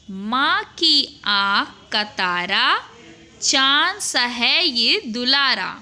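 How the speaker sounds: put-on slow, sing-song reading of a children's rhyme; children's poem recitation. Teacher reciting a rhyme from the book to children, slow and sing-song. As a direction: recite it slowly for children to follow.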